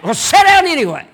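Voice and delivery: high, loud overruling command; stern insistence. Acted. Nehemiah overruling his stubborn self, loud and firm. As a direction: shut the argument down hard.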